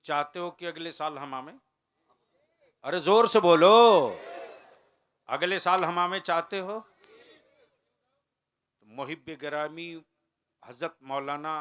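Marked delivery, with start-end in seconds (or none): 2.8-6.8 high, loud call for an answer; shouting at the crowd. Real, not acted. Preacher urging the audience to shout back, loud call.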